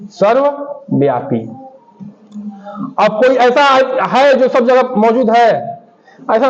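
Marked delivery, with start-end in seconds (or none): 0.1-1.4 सर्वव्यापी lower, split into serve and व्यापी; two-part सर्वव्यापी for note-takers; slow dictation in parts. Shown as a demonstration. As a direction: slowly repeat सर्वव्यापी with a gap after serve. Repeats answer in parts for class.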